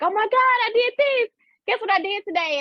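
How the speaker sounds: high, delighted imitation of an excited peer; excited squeal. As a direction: gush like someone proud of a small win. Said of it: "They're like".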